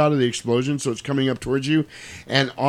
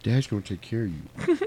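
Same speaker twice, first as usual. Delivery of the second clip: lower, softer soothing promise; mock tender comfort; 5 semitones lower, 6 dB quieter than usual. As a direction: hush her gently like a lover. Comforting kisses girlfriend in truck.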